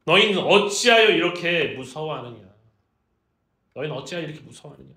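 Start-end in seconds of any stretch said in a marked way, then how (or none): 0.1-2.5 loud, stern rebuke; scolding. Acted. Jesus rebuking his frightened disciples, loud scolding. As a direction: reprimand them at full voice. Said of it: "꾸짖기 시작해요".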